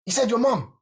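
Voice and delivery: high, loud outraged protest; indignant kid. Retold or imitated. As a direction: whine it like a furious kid defending himself. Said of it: "And I was like".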